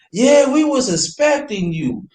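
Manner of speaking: high, drawn-out welcome; welcoming host, imitated. Club staff greeting expected guests, imitated welcome. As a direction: greet them slowly in a high voice.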